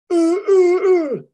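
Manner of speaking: high, loud choked gasping grunts; seizing gasps. Retold or imitated. Coach imitating a friend's dog seizing, high choked gasps. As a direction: mimic a dog's seizure in jerky gasps.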